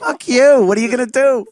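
high, loud jeering shout; mock heckler. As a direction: cut them off with a taunting yell. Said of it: "just loudly speaking in a megaphone over them".

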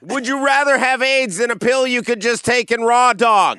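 very high, loud, hugely slowed "prep solved aids"; yelling after him. Retold or imitated. Comedian shouting after the man walking out, loud and drawn out. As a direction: bellow it after someone leaving.

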